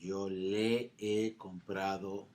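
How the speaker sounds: "Yo le he comprado" spelled out slowly, "le" leaned on; slow model. Shown as a demonstration. Teacher slowly modeling "Yo le he comprado" for students. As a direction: lay each word out slowly. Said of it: "teacher models the sentence".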